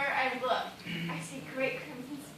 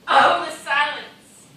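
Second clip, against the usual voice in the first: loud, slow wail; slow loud anguished oh; anguished cry; 2 semitones higher, 14 dB louder, much slower than usual. Acted. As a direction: cry out in despair. Desperate woman cries out.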